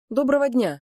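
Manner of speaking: polite greeting. A narrator's reading. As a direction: hail the innkeeper cheerfully. Traveller greets the innkeeper. (приветливо здороваясь)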